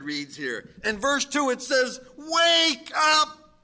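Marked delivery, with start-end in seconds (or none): 2.2-3.6 loud, high preacher's cry; ringing exclamation; shouted wake up. Acted. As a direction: call the church awake with force. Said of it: "Exclamation point".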